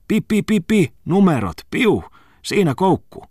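high mock puffing noises, slowing at the end; imitated puffs. Muttinen mimics the old scale, high playful pi pi noises. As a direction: play the scale's pops with a high silly voice.

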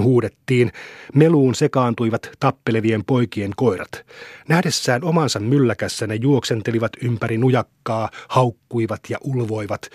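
plain reading voice

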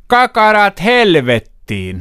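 retold yell. Retelling the adjutant chasing the kids away, laughing mock yell. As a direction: bellow it like the furious adjutant, half laughing. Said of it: "ja sit se huusi".

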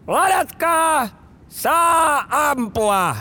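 high, very slow drawn-out shout; shouted command. Retold or imitated. Officer commanding the firing line, drawn-out shout. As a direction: stretch each command out over the range. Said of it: "taas tuli käsky ladata ja ampua".

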